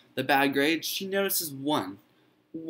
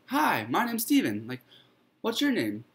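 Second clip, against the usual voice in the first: put-on pitched clearly higher, bright young hello; eager boy Stephen; 6 semitones higher than usual. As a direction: greet the old man like a keen kid. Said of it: "And he goes".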